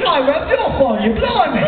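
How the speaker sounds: high, hammed-up mock British accent. Joining the mock British accent bit.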